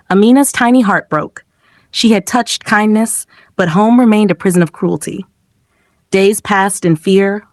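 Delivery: usual speaking voice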